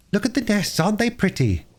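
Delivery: put-on bright eager little girl; a lightly voiced excited young girl. Phyllis voiced.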